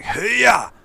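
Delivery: high, loud yelled battle grunts; hammed-up video game hero yells; mock hero battle cries. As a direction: shout like a silent game hero mid-fight. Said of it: "most of the stuff that you hear from your character is just like".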